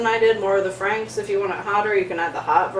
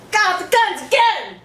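excited; 10 semitones higher than usual. Pretend. Flexing arms, excited.

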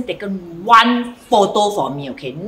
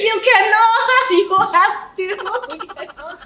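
laughing; 10 semitones higher than usual. Real, not acted. Host laughing at his bad luck.

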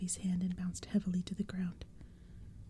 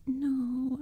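moaning; 5 semitones higher, 5 dB louder than usual. Acted. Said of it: "I moaned".

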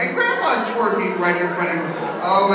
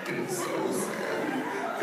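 sad whisper; 12 dB quieter, much slower than usual. Pretend. First take whispered.

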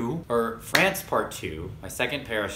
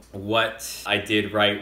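normal talking voice

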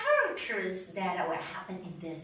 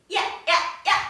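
quick, louder, higher yaps; little dog yapping; 5 semitones higher, 4 dB louder, much faster than usual. Shown as a demonstration. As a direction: yap like a small dog. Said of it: "the little ones".

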